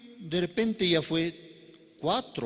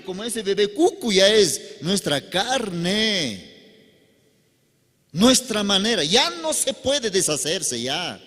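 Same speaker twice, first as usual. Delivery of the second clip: louder, insistent sermon delivery; fervent preaching; 6 semitones higher, 6 dB louder than usual. Acted. Preacher warning the congregation about the flesh, louder and fervent.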